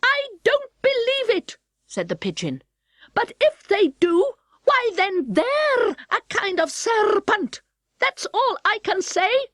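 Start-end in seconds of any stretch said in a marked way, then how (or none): 0.0-1.6 high, scoffing bird voice; pitched-up pigeon refusing to believe; high scornful pigeon; disbelieving pigeon. Acted. 3.1-9.5 high, slow reasoning bird voice; a smug pigeon, pitched up. Acted.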